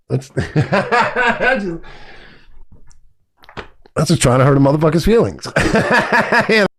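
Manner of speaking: laughing through the words. Real, not acted. Laughing while mocking people who insulted him. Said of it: "Holy shit".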